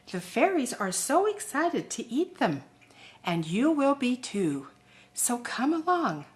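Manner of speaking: put-on soft, lilting invitation read to children; storybook invitation. Invitation to children for a fairy party, soft and lilting.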